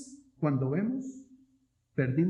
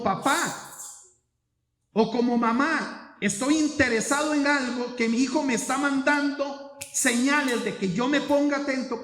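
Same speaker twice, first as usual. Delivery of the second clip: raised, fervent preacher's voice; preaching; 8 semitones higher, 4 dB louder than usual. Acted. Pastor pleading with parents, full preaching force. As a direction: preach it out high and loud.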